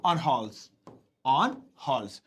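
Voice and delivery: on halls said twice, slow and clear, stress on on; teacher repeating the phrase on halls for the class; repeated for learners. Shown as a demonstration. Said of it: "come की जगह visit कर देते हैं".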